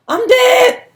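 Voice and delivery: high, loud panicked wail; sudden high-pitched cry of dread. Retold or imitated. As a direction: wail it like doom is coming. Panicking about wrecking mom's car.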